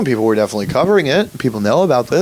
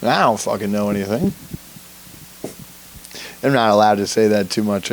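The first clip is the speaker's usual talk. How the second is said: ordinary talk, nothing marked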